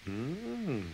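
a soft, contented hum of enjoyment; satisfied mm. Acted. Fat controller after the fish supper, soft contented mm. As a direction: hum like the meal was delicious.

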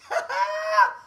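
high cackling laugh; high derisive cackle; mocking laugh. Real, not acted. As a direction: burst out in a high, scornful laugh. Mocking laugh during angry rant.

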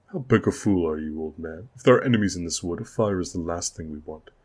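loud contemptuous rebuke; scornful scolding. Acted. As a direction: sneer at the old man, then hurry on. Lord scolds Garrett.

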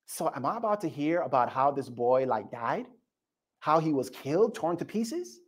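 put-on child imagining the boy's death, hushed; hushed dread. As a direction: ask it under your breath. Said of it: "now you're like".

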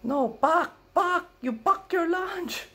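put-on high, loud, slow Filipino accented insistence; exasperated Filipino grandma. Grandma correcting grandson, exasperated, in a Filipino accent. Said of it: "No, she".

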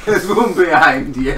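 laughing mimic. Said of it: "mocking the earlier warning".

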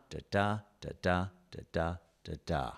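da da chanted fast, short-long beats; iambic rhythm demo. Shown as a demonstration. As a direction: tap out the iambic beat. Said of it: "the rhythm is da da".

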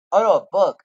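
a real startled correction, loud; surprised. Real, not acted. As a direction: catch your own mistake out loud. Realising it's a book.

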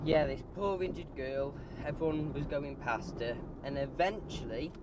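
ordinary talk

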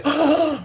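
a sharp high gasp of surprise; mock classroom gasp; imitated gasp. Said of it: "they gasp".

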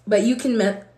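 ordinary talk

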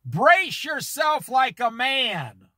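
slow and weighty, each word given room; preacher reading scripture slowly so it sinks in; slow deliberate reading. Shown as a demonstration. Preacher slows down so the congregation can think, slow weighty reading. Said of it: "read slow so you can think about it".

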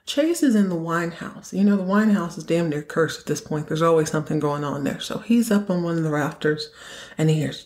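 ordinary talk, nothing marked

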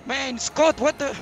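high-pitched, exasperated complaint; real frustrated outburst over team radio; exasperated protest. Real, not acted. Driver protests to engineer mid-race.

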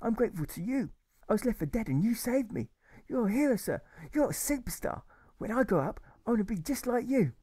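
put-on high, eager young boy; a boy in awe of his hero; admiring boy. Alfred tells the German he's a hero, admiring boy voice.

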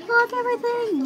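high-pitched mock yell. A narrator's reading. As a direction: pitch it way up like a shouted order. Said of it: "yelled Emma".

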